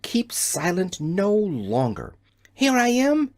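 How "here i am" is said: high, loud shout from the tower. A narrator's reading. Girl in tower shouting to friends below, loud high call. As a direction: call down as loud as she can. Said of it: "she shouted as loudly as she could".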